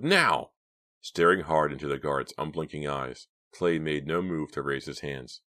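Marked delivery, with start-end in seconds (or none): 0.0-0.5 high, loud one-word shout; explosive demand; bellowed order. A narrator's reading. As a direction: bellow for instant obedience. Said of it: "the guard bellowed".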